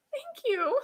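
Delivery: tiny, high, gushing thanks after a gasp; gasp, then coo it softly, hammed-up; mock flattered. Listener mistaking a Spanish insult for praise, mock flattered.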